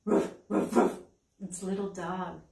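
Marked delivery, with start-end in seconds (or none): 0.1-0.9 breathy mock dog bark. Librarian barks like a dog for the children. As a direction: bark like a puppy for the kids.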